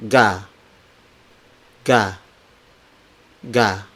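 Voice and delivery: "ga" with no puff of air after G; unaspirated G. Shown as a demonstration. Teacher models the letter G, "ga" without aspiration. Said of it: "these consonants are unaspirated".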